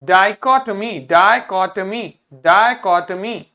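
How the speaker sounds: डाइकोटमी loud and slow in syllables; pronunciation drill. Shown as a demonstration. Teacher drilling pupils on a hard word, loud syllabic डाइकोटमी. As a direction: punch out डाइकोटमी part by part. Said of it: "इसको पढ़िए".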